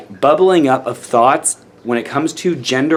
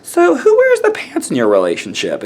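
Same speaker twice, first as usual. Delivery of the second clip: higher-pitched imitation of a clueless asker; mock stupid question; 5 semitones higher than usual. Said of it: "stupid bigoted comments".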